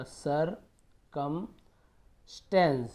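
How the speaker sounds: "सर, कम, स्टेंस" with long pauses between pieces; syllable by syllable. Shown as a demonstration. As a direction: break "सर, कम, स्टेंस" apart for learners. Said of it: "तोड़ तोड़ के पढ़ेंगे".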